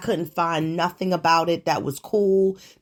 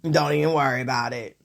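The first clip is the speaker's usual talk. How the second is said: mock dismissive; 7 semitones lower, faster than usual.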